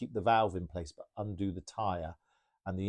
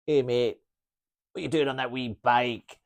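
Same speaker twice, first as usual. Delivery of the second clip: higher, louder Glaswegian mocking voice; Glaswegian accent; 4 semitones higher, 7 dB louder than usual. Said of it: "should I do a Glaswegian accent?".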